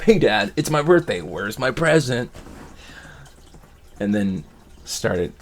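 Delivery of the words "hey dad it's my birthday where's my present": loud, higher, playful birthday ask, slowing at the end; chatty and cheerful. Retold or imitated. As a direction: chat Dad up like a happy kid. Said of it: "chatting it up".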